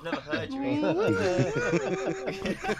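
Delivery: high, slow laughing squeal; laughing disbelief. Real, not acted.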